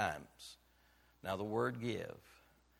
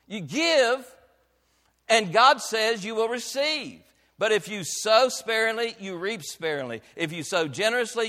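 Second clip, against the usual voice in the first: loud, high, ringing pulpit voice; full-throated revival preaching; preaching at full force; 10 semitones higher, 12 dB louder than usual. Acted. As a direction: preach it out to the back pew. Preacher driving home the harvest.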